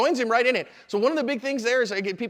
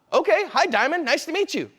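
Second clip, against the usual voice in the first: friendly greeting; 6 semitones higher than usual. Shown as a demonstration. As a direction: greet him like an old friend. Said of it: "Have I built rapport with him? No".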